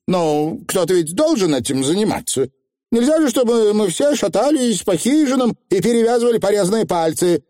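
high, defensive witch; high-pitched, snooty indignation; haughty young witch. Acted. Voices Anagramma, defensive.

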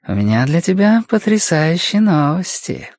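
lightly voiced seductive caller; purring woman. A narrator's reading. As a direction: purr the news teasingly into the phone. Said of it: "промурлыкала она".

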